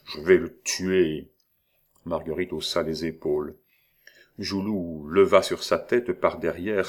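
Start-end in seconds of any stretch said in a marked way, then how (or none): 0.1-1.3 slow grating voice, level; grating threat. A narrator's reading. Joulou at Marguerite's ear, slow grating menace. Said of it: "grinçait la voix de Joulou".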